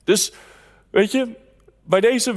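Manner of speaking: ordinary delivery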